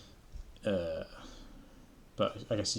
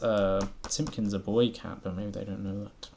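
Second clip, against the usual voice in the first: ordinary delivery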